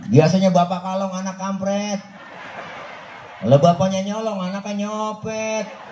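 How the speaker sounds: a hint of joking scorn; Jibril mocking. Jibril scoffing at the Prophet's patience, laughing. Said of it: "Apa kata Jibril".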